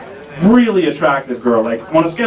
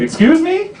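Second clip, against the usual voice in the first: mock-offended question, pitched up sharply at the end; offended retort; 10 semitones higher than usual. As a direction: imitate an affronted coworker's high rising query. Coworker offended by age remark.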